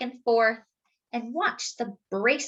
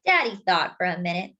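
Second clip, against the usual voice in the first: ordinary delivery, nothing marked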